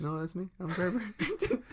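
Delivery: soft, strange breathy put-on voice; badly disguised breathy guest voice; Kreiber. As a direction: insist you're the guest in an airy voice. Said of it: "breathy voice".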